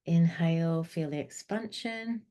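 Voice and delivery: soft, slow counting through each breath; calm breathing coach, counting gently; guided meditation. Acted.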